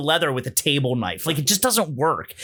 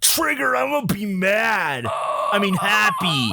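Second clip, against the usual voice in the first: higher, gleeful troll taunt; smug troll voice; 4 semitones higher than usual. Retold or imitated. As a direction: fake anger, then gloat. Mocking the author's troll persona.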